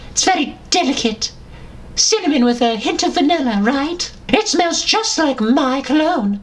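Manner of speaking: house elf Dobby. Acted. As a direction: chirp it out as a cheeky little elf. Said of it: "as a little house elf".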